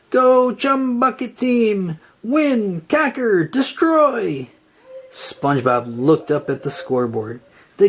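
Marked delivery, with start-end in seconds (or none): 0.1-4.5 higher, slow villainous cheering; loud cheer. A narrator's reading. Plankton cheers his team from the sidelines, high slow cheering. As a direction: cheer on the team like a gleeful tyrant. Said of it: "cheered loudly from the sidelines".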